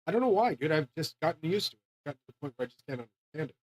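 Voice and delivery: ordinary talk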